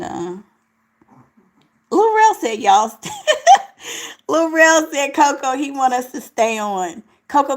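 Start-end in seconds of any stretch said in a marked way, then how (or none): 3.2-4.2 sudden high-pitched laugh; high burst of laughter. Real, not acted. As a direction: break into a loud, high laugh. Laughing at Laurel's comment.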